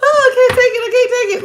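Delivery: high, loud helpless laughter; laughing so hard she can't go on. Real, not acted. Reading a tarot client, she cracks up laughing. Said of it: "I see you laughing at me".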